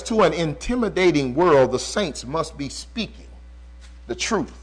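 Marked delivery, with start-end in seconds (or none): 1.7-4.5 hushed emphasis. Real, not acted. Preacher drops voice for key line.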